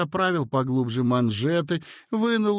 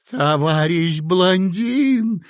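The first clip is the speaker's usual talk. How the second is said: louder, slow, drawn-out cry; exhausted clerk crying out; a long, tearful call; weeping plea; 5 semitones higher, 6 dB louder, much slower than usual. A narrator's reading. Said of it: "плакал истомлённый Коротков".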